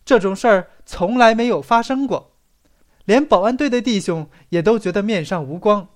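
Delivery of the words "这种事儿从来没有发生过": usual speaking voice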